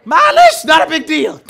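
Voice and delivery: high shouted dismissal, dropping on the last words; kids' brazen brush-off. Acted. Kids answering a parent about the towel, brazen shouted brush-off. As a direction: shout it off like it's nothing. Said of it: "received the gift of tongues and tell you".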